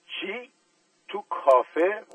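a scandalised cry of surprise; shocked question. Acted. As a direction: voice jumping high in disbelief. Relatives asking together in shock.

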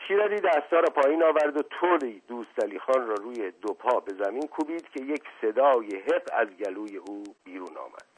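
ordinary delivery

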